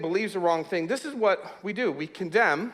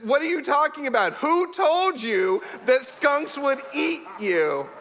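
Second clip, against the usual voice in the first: high, drawn-out exasperated questioning; imitated disbelief, slowing for emphasis; incredulous scoutmaster; 6 semitones higher, slower than usual. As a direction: act out a flabbergasted scoutmaster. Scoutmaster incredulous at the boy's fear.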